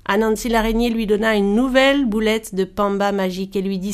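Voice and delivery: usual speaking voice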